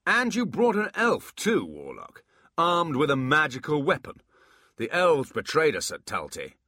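loud, high accusing demon. Acted. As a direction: hurl the accusation. Abbott accuses.

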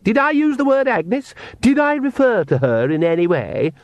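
high, icy questions; cold. A narrator's reading. Sister denying she mentioned Agnes, coldly. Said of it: "said Lacrimosa coldly".

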